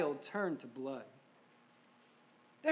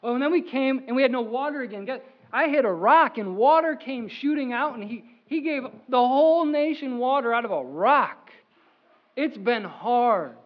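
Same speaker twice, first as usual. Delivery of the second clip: louder, telling the rock miracle; excited loud telling; 6 dB louder than usual. Pretend. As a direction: shout the miracle out. Preacher as Moses, loud.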